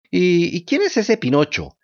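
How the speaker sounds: slow, stammering feigned innocence; playing dumb. A narrator's reading. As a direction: pretend you know nothing. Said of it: "haciéndose la desentendida".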